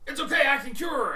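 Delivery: high, loud, fast burst of hope; frantic reassurance. Acted. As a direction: rush to help in a panic. Acted character rushes to heal fallen companion.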